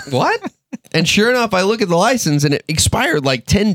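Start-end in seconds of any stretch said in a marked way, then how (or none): 0.1-0.5 shocked what. Retold or imitated. Retelling shock at expired license.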